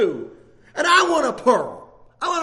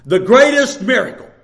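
greatest punched louder; stress on greatest; 2 semitones lower, 8 dB louder than usual. Retold or imitated. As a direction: lean hard on greatest. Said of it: "this is the way she said it".

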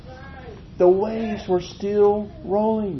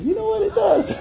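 surprised; 14 semitones higher than usual. Retold or imitated. Retelling his reply to his wife.

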